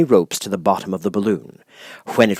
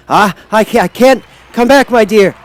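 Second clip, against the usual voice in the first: louder, much higher calling from above; 11 semitones higher, 7 dB louder than usual. A narrator's reading. The Wizard calls down to Dorothy, louder and higher. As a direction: shout down to her from the basket. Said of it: "called Oz from the basket".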